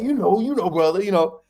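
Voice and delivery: a knowing coach aside in his voice; Jamarcus Shepherd voice. Retold or imitated. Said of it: "this my Jamarcus Shepherd voice".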